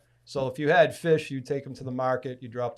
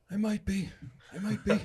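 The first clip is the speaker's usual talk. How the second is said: higher, teasing mock reply; coy imitation of Nate; 8 semitones higher than usual. A hint of Nate teasing the young fisherman. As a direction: playfully dodge the compliment.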